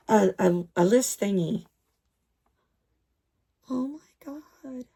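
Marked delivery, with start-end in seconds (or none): 3.7-4.8 voiceless, stunned oh my God; awed whisper. Real, not acted. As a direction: breathe it out in awe. Stunned aside.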